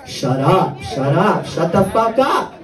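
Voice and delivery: low retort building to drawn-out exasperation; mock exasperation, slowing for the final demand; fed-up outburst. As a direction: act out losing patience, rising on the last. Fed-up retort to repeating brother.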